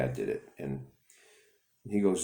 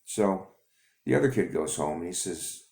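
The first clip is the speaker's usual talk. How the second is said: usual speaking voice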